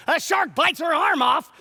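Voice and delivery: high, loud blurt; sudden loud, high-pitched outburst; dramatic shout. Real, not acted. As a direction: shout the shocking part. Dramatic blurt in his story.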